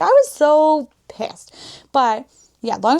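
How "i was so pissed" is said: slow, louder, annoyed; drawn-out angry emphasis; loud and pissed. Real, not acted. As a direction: lean on it, still fuming. Recalling anger, louder.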